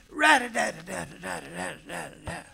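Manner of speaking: imitated rattle of shaken crib bars; mock rattling of crib rails; rattling bars noise. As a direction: rattle it out like a scared kid shaking the rails. Child shaking crib bars in fear.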